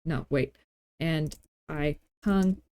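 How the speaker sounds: ordinary delivery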